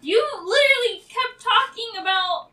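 indignant comeback; exasperated retort. Acted. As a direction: push back loudly. Argues back at the talkative doctor.

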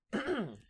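clears throat before telling the tale; a rough throat clear; phlegmy throat clearing. Real, not acted. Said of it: "Let me clear my throat".

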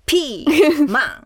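playful emphasis. Shown as a demonstration. Showing the crisp pepper, playful.

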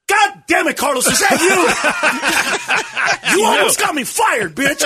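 high-pitched mock yell through laughter; loud angry outburst. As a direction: act out an exasperated clerk blowing up. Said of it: "So I told him to F off really loud".

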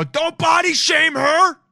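slow, high mock shout of defence; hammed-up protective yell; stretched-out loud defence of girlfriend. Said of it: "And I go".

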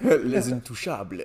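put-on slow, laughing French accent; exaggerated French pronunciation, laughing. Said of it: "Please say it in the French accent".

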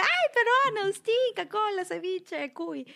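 squealing high with delight; excited Peruvian. Retold or imitated. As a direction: gush like a homesick Peruvian. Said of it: "Se emocionan y dicen".

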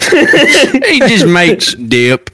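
slow, laughing joke. Real, not acted. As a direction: drag it out while laughing.

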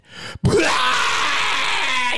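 high shouted laugh; burst of laughter. Real, not acted. Recalling crowd reaction.

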